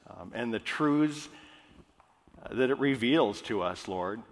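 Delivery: prayer. Acted. Pastor praying over the congregation, hushed and reverent. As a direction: pray it quietly and reverently, slowing on the praise. Pastor prays before the sermon.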